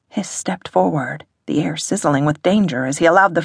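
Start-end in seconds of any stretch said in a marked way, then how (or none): none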